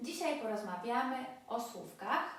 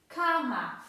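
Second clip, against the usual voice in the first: Kama with a raised, forceful first syllable; penultimate stress; 4 semitones higher, 8 dB louder than usual. Shown as a demonstration. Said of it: "akcent właśnie pada na".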